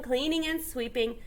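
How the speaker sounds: usual speaking voice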